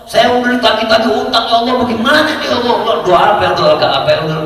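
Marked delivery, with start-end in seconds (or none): 0.0-2.7 much higher, pleading hammed-up wail; half-joking panicked plea, pitched up; mock desperate prayer. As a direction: imitate a frantic debtor crying to God. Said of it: "kita jerit-jerit".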